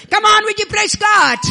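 loud, high shout for praise; preacher's call. Real, not acted. Preacher calls the congregation to praise God loudly. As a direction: lift the crowd up with a shout.

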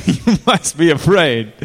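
usual speaking voice

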